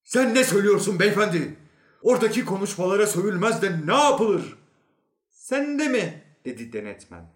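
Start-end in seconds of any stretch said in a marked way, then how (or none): 0.0-4.6 loud, high, indignant protest; shouting. A narrator's reading. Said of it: "Bağırdı". 5.3-6.2 high-pitched indignant question; outraged disbelief. Acted. Inspector outraged at the newcomer.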